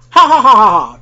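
loud acted ha-ha laugh; big, spelt-out mocking guffaw; uncontrollable laughter. A narrator's reading. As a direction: belt out a hammed-up laugh. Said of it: "rolling on the ground in uncontrollable laughter".

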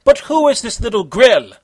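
taunting villain. A narrator's reading.

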